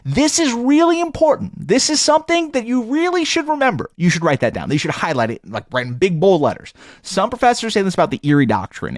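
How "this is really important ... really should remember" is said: much higher, emphatic professor voice; mock professor. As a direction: play the lecturing professor pitched way up. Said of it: "that they say".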